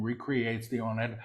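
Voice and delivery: quick, soft undertone; hushed preview. Real, not acted. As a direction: say it softly and rapidly before proclaiming it. Quick soft take before repeating aloud.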